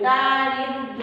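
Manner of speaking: "দারিদ্র" slowly as daa-rid-dro; silent glide. Shown as a demonstration. Teacher shows the letter stays silent, slowly syllabified. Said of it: "হবে না, অনুচ্চারিত থাকবে".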